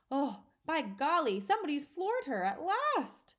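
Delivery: high, loud delighted crow; gleeful cry. A narrator's reading. A teacher crows that Trunchbull is floored, delighted. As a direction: crow it with a grin. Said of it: "cried one of the men, grinning".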